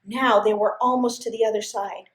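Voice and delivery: ordinary delivery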